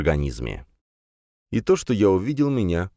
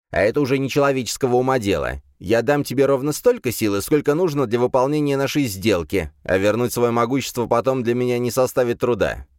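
dismissive demon lord; 5 semitones higher than usual. Acted. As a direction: brush him off with contempt.

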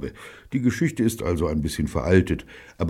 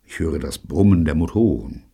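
plain reading voice

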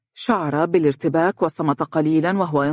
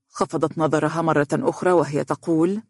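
ordinary delivery, nothing marked

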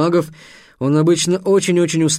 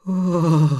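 babbling, bewildered; 3 semitones higher than usual. A narrator's reading. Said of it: "пролепетал Трикс".

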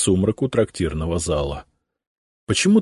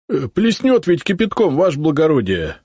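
high, loud worried objection; objecting constable; 10 semitones higher, 4 dB louder than usual. A narrator's reading. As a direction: protest nervously to the boss. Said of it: "возразил один из них". (испуганное возражение)

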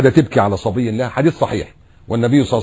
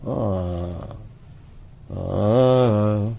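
resigned sigh; 4 semitones lower, 6 dB quieter, much slower than usual. Retold or imitated. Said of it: "نعمل إيه بقى".

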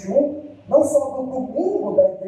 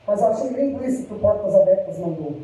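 usual speaking voice

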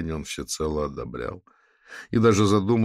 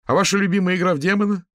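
high, loud ringing student voice; 13 semitones higher, 6 dB louder, faster than usual. A narrator's reading. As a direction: ring out across the square. Student continues his complaint.

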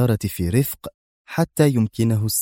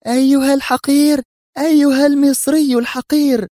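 high, loud furious shriek at the man; shrieking woman, enraged; furious scream; 6 dB louder than usual. Acted. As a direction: hurl the insult at the top of her voice. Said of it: "صرخت في ثورة".

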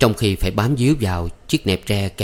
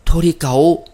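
higher, louder, short of breath; breathless protest; 8 semitones higher, 6 dB louder than usual. Acted. Maid held by the young master, breathless protest. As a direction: gasp out "Thôi đi cậu" breathlessly. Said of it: "Thắm thở mau".

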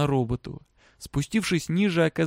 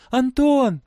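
high-pitched loud call; shout; 11 semitones higher, 6 dB louder than usual. A narrator's reading. Girl running to her father's cell, shouting. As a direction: cry the name high and loud. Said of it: "крикнула девочка".

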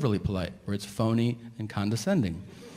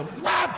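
high mock whap of the flush; cartoon flush noise; flush sound effect. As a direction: pop out a high little whap. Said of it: "I just go".

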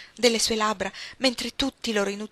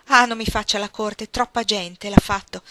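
louder, sharp reproach; frowning rebuke; 8 dB louder than usual. A narrator's reading. Ellen rebuffing Archer's courtship, loud stern reproach. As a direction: push him away with a stern frown. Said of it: "disse accigliandosi".

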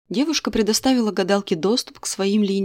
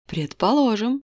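fortune teller; 3 semitones higher than usual. Acted. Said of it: "усмехнулась девушка".

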